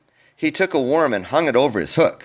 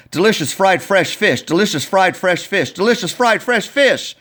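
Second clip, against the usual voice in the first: tongue twister attempt; 6 semitones higher, 3 dB louder than usual. Shown as a demonstration. Coach trying the tongue twister for the kids. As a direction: rattle off the tongue twister three times, rising on the last. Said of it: "I'll give it a try".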